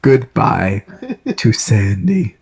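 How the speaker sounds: ordinary delivery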